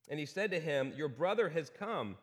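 ordinary delivery

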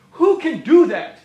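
ringing rhetorical question thrown at the room; preacher booming the question; loud challenge. Acted. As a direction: call it out so everyone feels it. Rhetorical question then repeated hushed.

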